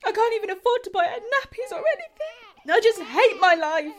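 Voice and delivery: tearful despair. Acted. Katie confessing to Cher, crying in despair. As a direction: break down on the last line.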